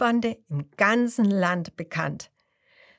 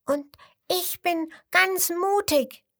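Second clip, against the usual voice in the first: much higher, slow brave little voice; a tiny croc trying to sound brave; little crocodile call; 10 semitones higher, slower than usual. A narrator's reading. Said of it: "Das fünfte ruft". (kleines Krokodil ruft hoch und mutig)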